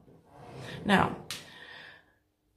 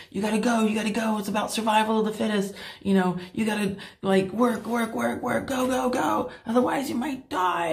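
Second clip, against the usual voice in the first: pushy stepfather; 4 semitones higher than usual. Retold or imitated. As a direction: hammer each go and work like relentless pressure. Stepfather's constant pushing voiced.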